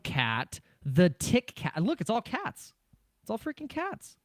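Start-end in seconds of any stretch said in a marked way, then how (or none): 1.8-2.7 high, hushed squeak; squeaky mock voice. Streamer to chat, squeaking in mock disbelief. Streamer repeating, rising in pitch. 3.2-4.2 very high, hushed, cartoonish squeak; squeaky mock voice. Streamer to chat about all the cat coins, tiny high squeal.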